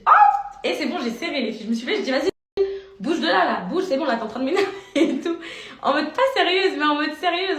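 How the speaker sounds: hammed-up telling off; fed-up shouting back. Vlogger replaying how she yelled at the woman to back off, loud and high. Retells her own angry outburst.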